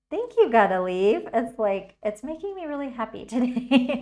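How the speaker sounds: loud happy thanks to a viewer, laughing; thrilled. Real, not acted. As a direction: gush your thanks, beaming.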